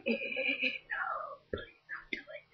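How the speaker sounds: high, soft whispered pleading; tiny whispered please don't. Retold or imitated. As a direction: beg under your breath. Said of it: "you just kinda like".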